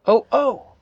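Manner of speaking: high, frightened cry; shouting. A narrator's reading. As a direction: yelp as the tree flies past. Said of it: "he shouted".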